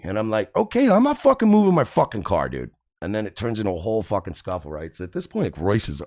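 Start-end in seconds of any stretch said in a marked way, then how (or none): 0.5-2.7 high, heated retort; acted angry refusal. Renter refusing to move his car, high angry retort. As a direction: dig in and refuse, pitch shot up.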